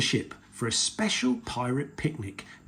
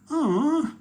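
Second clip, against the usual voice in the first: put-on high, loud childish "aw"; hammed-up sigh of disappointment; disappointed sigh; 14 semitones higher, 5 dB louder than usual. A narrator's reading. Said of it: "sighed Peppa".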